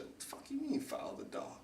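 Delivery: whispered in disbelief; hushed whisper. Retold or imitated. Baffled at the lumberyard counter, asking in a whisper. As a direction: whisper it so the counter won't hear.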